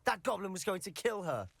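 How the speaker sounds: high, strained and pleading; desperate nephew justifying himself; anguished protest. Acted. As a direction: cry out that you had no choice. Pleading against uncle's judgement.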